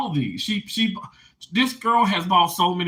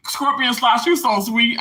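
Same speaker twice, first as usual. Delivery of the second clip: ordinary delivery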